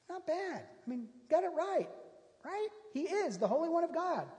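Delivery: high, wry amused comment; wry aside. Real, not acted. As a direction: tease the demon's right answer. Mock-impressed aside on demon.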